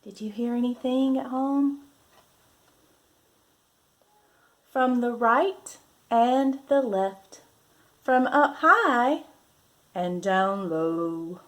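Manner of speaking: lively, drawn-out picture-book voice; reading to children. Acted. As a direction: read it like a storybook for little ones.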